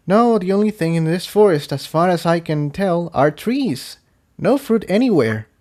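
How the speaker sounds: a small pony, let down; Tiptoe voice. Acted.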